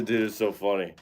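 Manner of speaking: usual speaking voice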